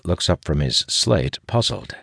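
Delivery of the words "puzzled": plain reading voice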